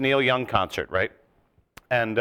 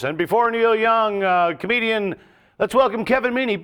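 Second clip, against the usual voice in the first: high, loud, booming announcer voice; stage announcer intro; 9 semitones higher, 4 dB louder than usual. Retold or imitated. Comic mimicking the arena announcer, loud and high. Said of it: "they introduce".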